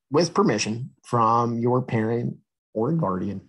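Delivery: plain reading voice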